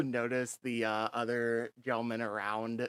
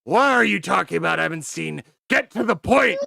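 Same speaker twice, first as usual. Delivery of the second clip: loud, higher, impatient shouting; angry commander; 8 semitones higher, 15 dB louder than usual. Acted. Commander yelling at the major over the radio. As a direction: bark it like a furious officer.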